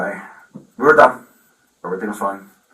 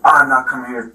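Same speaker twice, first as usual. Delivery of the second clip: mild mock yell, louder and higher; retold scream; 4 semitones higher, 8 dB louder than usual. Daughter refusing dad's call to come here, retold as a mild scream. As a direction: imitate a defiant scream without going all out.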